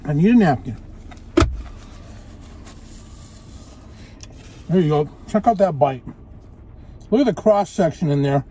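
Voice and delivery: eating between words; talking while chewing. Real, not acted. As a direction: mouth full of burger. Seen 0: Bit burger at -2.0; chews and wipes mouth while talking.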